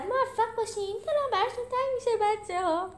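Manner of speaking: squeaky, excited farewell shout at moderate volume; high, hurried send-off, lightly shouted; high-pitched call. A narrator's reading. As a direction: call out high and quick to departing friends. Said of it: "پنی داد زد".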